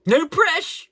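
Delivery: high, loud mocking "no presh"; mocked slang. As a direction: parrot the slang in exaggerated disbelief. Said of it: "They don't say".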